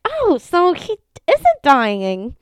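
slow, high relieved surprise; mock relief. Owner to vet, slow high relieved surprise. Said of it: "and I was like".

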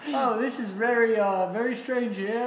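high, hammed-up announcer voice; flustered broadcaster covering an incident; mock commentator. Commentator voiced after cut to commercial.

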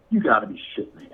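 quick, muttered in disbelief; fast low grumble of disbelief. Retold or imitated. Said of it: "and he goes".

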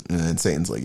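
ordinary talk, nothing marked

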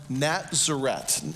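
"Nazareth" said as Natzeret; slow Hebrew "Nazareth", nat-zeh-RET; Hebrew pronunciation. Shown as a demonstration. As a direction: say "Nazareth" with a ts and a final t. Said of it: "That's Nazareth in the Hebrew".

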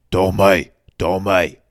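put-on loud Birmingham-accented call; Arthur. Middle brother calling Tommy, loud Birmingham accent. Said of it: "That's Arthur".

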